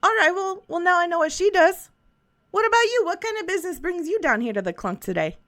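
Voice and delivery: lightly voiced friendly old shopkeeper; a folksy merchant, easygoing; shopkeeper Schnick Tick.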